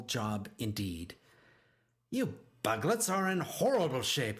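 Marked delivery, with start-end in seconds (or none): 2.1-4.4 grumbling. A narrator's reading. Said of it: "he grumbled".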